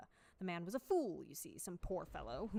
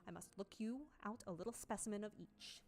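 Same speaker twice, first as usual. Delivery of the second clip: usual speaking voice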